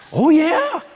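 high, emphatic assurance; eager yes. Retold or imitated. Acts healed man's eager answer.

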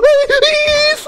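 very high, loud shriek; shrieked in fright; real startled scream. Real, not acted. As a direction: scream from a sudden jump scare. Startled by jump scare in game.

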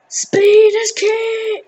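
high, loud, stretched-out cry; long whooping game shout; drawn-out shout. Real, not acted. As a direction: belt it out slowly, high above normal talk.

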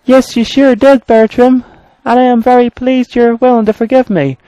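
very high, eager engine voice; high-pitched polite little engine; pleased engine character. Acted. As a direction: pitch it way up, gratefully.

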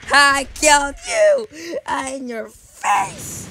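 loud, high triumphant laugh-shout; gloating taunt. Real, not acted. Gamer taunting the player he pushed off, loud gloat. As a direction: rub it in their face.

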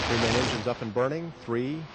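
excited launch call. Real, not acted. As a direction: announce the ignition with rising energy. Engine ignition call at launch.

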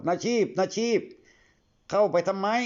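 high, slow drawn-out calling. Acted. A boy calls after Nachip vanishing into the forest, long high shouts. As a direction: call his name across the dark forest. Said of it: "แกก็เลยตะโกนถามว่า".

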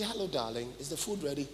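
a soft, tender request to the wife; polite loving request. Retold or imitated. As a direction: ask gently, like a caring husband.

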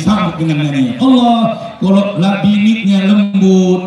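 ordinary delivery

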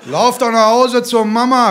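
calling out. A narrator's reading. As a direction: shout a taunt onto the pitch. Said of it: "rief er auf den Platz".

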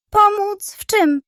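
a high, loud, curious bird voice; the gull cocks its head and asks; gull's voice. Acted.

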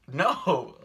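sharp, loud and high refusal. Retold or imitated. To the owner asking her to hold the dog, a loud high no. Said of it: "I don't say it".

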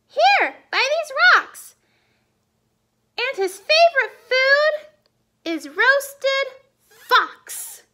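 tiny mouse turning down the fox; little mouse. Acted. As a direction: play the mouse, bright and plucky.

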